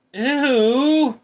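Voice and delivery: high loud disgusted "Ew!". Real, not acted. Streamer recoiling at a gross chat message, loud disgusted ew. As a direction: squeal it out in disgust.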